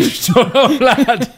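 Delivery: laughing. Pretend. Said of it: "I said".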